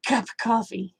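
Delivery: soft whisper. Real, not acted. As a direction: whisper it to yourself. Whispers to herself late.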